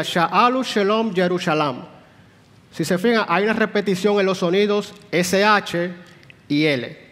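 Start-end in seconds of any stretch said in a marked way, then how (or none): 0.0-1.8 "Sha'alu Shalom Jerusalem" read slowly in Hebrew, leaning on the S, SH and L sounds. Shown as a demonstration. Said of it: "Si ustedes lo leen, que es la segunda línea, sería". ("Sha'alu Shalom Jerusalem" leído despacio en hebreo, marcando las S, SH y L)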